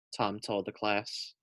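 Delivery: ordinary delivery, nothing marked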